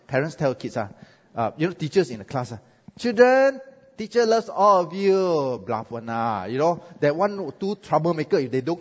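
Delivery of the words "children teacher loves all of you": high, loud, slow schoolteacher sweetness; sugary teacher voice. Retold or imitated. Mimicking a teacher assuring the class, high and slow.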